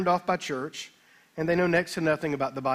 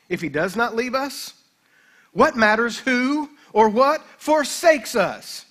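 louder quoted reading; 3 semitones higher, 4 dB louder, slower than usual. Acted. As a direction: read the commentary with rising force. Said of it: "John Brown made this remark".

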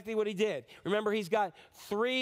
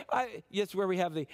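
ordinary delivery

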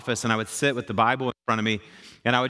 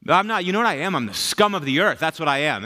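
raised, worked-up mock rant; self-loathing protest; 4 semitones higher, 3 dB louder than usual. Young pastor arguing his worthlessness to Jesus, self-loathing protest. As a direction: insist on it in comic exasperation. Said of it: "tell God that he's wrong".

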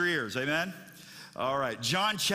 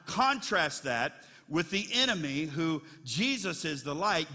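usual speaking voice

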